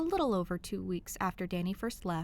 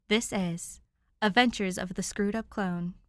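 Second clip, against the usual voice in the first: usual speaking voice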